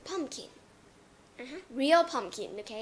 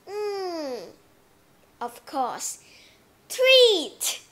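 homeowner choosing treat; 4 semitones higher, 12 dB louder, much slower than usual. Acted. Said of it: "If you said".

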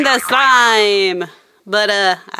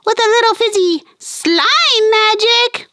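high, fast cartoon puppet chatter; squeaky puppet voice; 9 semitones higher than usual. Fizzy the puppet cheering Phoebe, high cartoon voice. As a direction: chirp it like a tiny cartoon friend.

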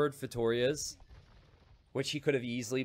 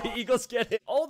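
high laugh through the words; 13 semitones higher, 6 dB louder than usual. Real, not acted. Caster cracking up at his own pun, laughing. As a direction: giggle the line out.

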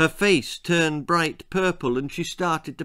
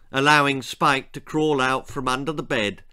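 ordinary delivery, nothing marked

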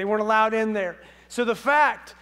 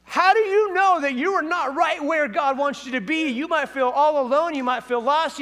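ordinary talk, nothing marked

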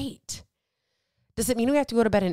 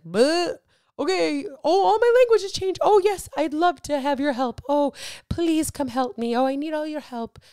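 much higher and louder gushing voice; mock eager gushing; 8 semitones higher, 5 dB louder than usual. Imagined self waking up changed, hammed-up high gushing. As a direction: ham up sudden eagerness for help.

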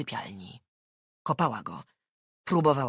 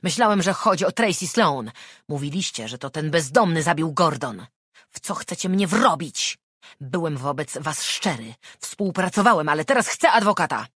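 angry outburst; 3 semitones higher, 9 dB louder than usual. Acted. Suspect angrily demanding lawyer.

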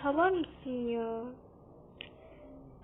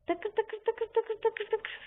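higher, slow rattling takır takır; gun sound imitation; 6 semitones higher, 4 dB quieter than usual. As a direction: rattle it off like a toy gun firing. Imitating the new gun firing. (tiz ve yavaş silah sesi taklidi)

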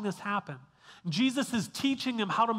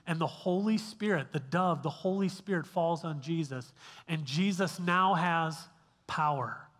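usual speaking voice

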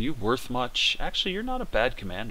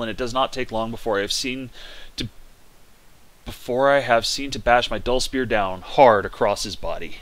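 ordinary talk, nothing marked

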